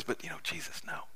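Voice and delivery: hushed, breathy whisper pleading in private; whispered aside. Retold or imitated. Peter pulling Jesus aside, whispering his devotion. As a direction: whisper it close, as if only Jesus may hear. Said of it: "I don't know whether he whispers this".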